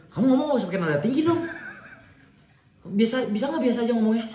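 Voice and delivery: high-pitched mock scolding. Girlfriend tells boyfriend to drop the high tone, mock indignant high pitch. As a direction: imitate an indignant telling-off, pitched well up. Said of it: "Kan kaget ya".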